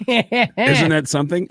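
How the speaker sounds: lightly voiced Gene working the room; [name] voice. Imitating Gene addressing strippers.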